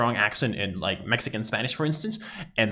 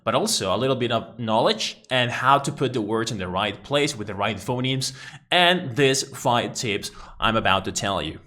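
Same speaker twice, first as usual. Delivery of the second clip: ordinary delivery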